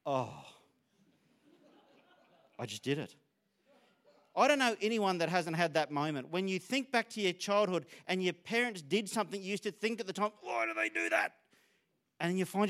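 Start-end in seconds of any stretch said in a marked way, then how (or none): 0.0-3.1 low, sinking mock dismay; dismayed realisation. Parent catching himself copying his dad, low mock dismay. 10.4-11.4 high, put-on kid's protest; complaining child. Child puzzled by parents' habits, high mock complaint.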